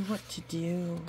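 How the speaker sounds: plain reading voice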